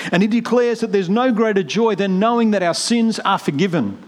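fervent preaching. Acted. Preacher urging the church back to joy, fervent. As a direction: lift the congregation with rising urgency.

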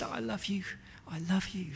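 soft, high, tearful voice; acted sobbing. Mum hugging her child, softly tearful. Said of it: "she sobbed and she hugged me".

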